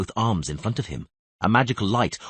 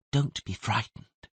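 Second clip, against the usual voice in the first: soft, quiet coaxing murmur; gentle soothing voice; 2 semitones higher, 5 dB quieter, slower than usual. Acted. Kneeling stranger coaxing a dying man to talk, softly. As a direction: lull him while pressing for names. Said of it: "said the gentle voice".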